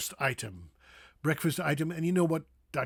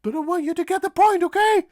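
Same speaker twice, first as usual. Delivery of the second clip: very high, squeaky cartoon mouse voice; high-pitched, playful teacher; Mickey Mouse; 12 semitones higher, 4 dB louder, faster than usual. Shown as a demonstration. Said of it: "talking like Mickey Mouse".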